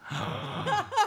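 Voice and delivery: high, soft gasp; mock shocked gasp. Passenger reacting to driver's complaint, acted shocked gasp. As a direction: suck in air high, acting appalled.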